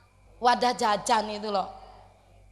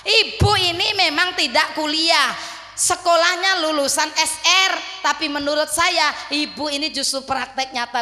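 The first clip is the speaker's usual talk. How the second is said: fiery preaching; 6 semitones higher, 7 dB louder than usual. Acted. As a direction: preach it out to the crowd. Preacher raising voice. (khotbah berapi-api, keras dan lambat)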